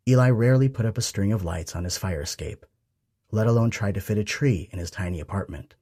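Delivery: ordinary delivery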